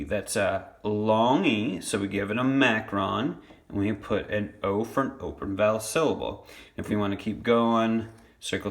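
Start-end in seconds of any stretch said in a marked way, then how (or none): none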